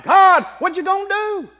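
high, loud cry to God; preacher shouting Habakkuk's demand at God; screaming out. Retold or imitated. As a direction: hurl the question upward at full voice. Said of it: "I'm screaming out".

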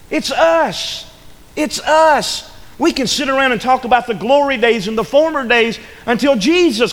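hammered-out revival preaching; full-force preaching. Acted. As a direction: build the sermon to a fervent peak.

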